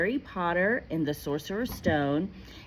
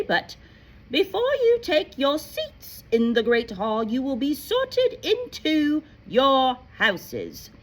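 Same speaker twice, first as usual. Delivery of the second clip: higher, formal professor voice; McGonagall's speech; 6 semitones higher, 4 dB louder than usual. Acted. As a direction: address the first-years like a stern headmistress. Teacher voices McGonagall's long speech.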